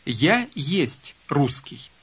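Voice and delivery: slow, word by word; slow literal rendering. Shown as a demonstration. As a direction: spell out each word slowly. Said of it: "дословно нужно произнести". (медленно, по словам)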